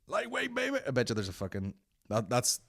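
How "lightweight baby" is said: very high, softer mock bodybuilder crow; Ronnie Coleman impression. Repeating the Ronnie Coleman catchphrase, in a high mock voice. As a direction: toss it off like a showoff lifter. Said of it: "Ronnie Coleman videos".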